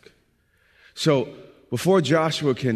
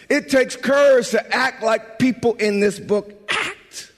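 slow, rising higher and louder each line; building preaching; 9 semitones higher, 5 dB louder, slower than usual. Acted. Preacher urging his congregation to courage, slow and building. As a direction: build the refrain like a preacher climbing.

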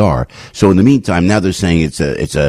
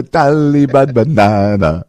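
slow, drawn-out mock Taliban accent; 6 semitones higher, slower than usual. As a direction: stretch "Taliban banana" out in a put-on Taliban accent. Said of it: "is that a Taliban accent?".

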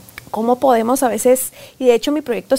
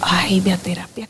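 joking whisper; 5 semitones lower, 3 dB quieter than usual. Real, not acted. Joking answer to host.

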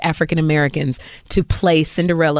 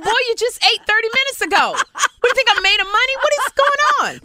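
very high-pitched exasperated scolding; mock-outraged mom rant; exasperated mother. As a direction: scold a child in high, incredulous disbelief. Mother scolding son in story.

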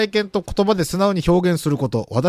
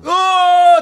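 high loud yell of pain; mock scream; 11 semitones higher, 7 dB louder, much slower than usual. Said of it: "って言ってたんすか".

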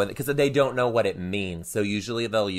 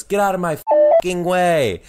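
put-on higher, louder rude kid demand; angry kindergartner; 9 semitones higher, 7 dB louder than usual. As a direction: snap it like a cross little boy. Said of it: "he looks at me and goes".

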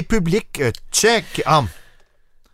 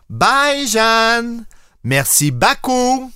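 au revoir chantant, plus aigu et plus lent; imitation de Fred; 8 semitones higher, 3 dB louder, much slower than usual. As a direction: imite ton ami qui fait un adieu exagéré. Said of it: "je me rappelle de toi qui fais". (adieu chantonné en imitant Fred, voix montée et lente)

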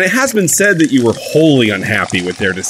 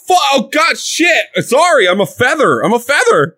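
startled; 13 semitones higher than usual. Real, not acted. Startled by the booming demon voice.

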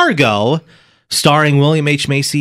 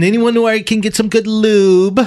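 lightly voiced creeper, higher pitch; 7 semitones higher than usual. Reading out a creeper's text to the show, a hint of creepy. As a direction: read it as a creepy texter, pitched up. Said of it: "this creeper is texting in".

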